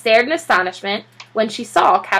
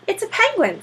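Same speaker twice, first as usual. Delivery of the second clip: proud; 11 semitones higher than usual. Acted. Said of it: "said Mister Popper proudly".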